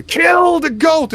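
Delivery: very high, drawn-out war shout; battle cry. Acted. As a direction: shriek it across a battlefield, loud and slow. Battlefield cry voiced by game master.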